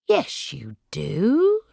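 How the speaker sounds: slow, firm insistence; insistent. A narrator's reading. Sarah insists Corrie knows her father. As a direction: lean on it stubbornly.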